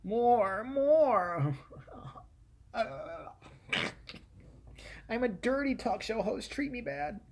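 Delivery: mock wife egging on. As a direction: play the host's wife, coaxing and cheeky. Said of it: "A little wife of his would probably".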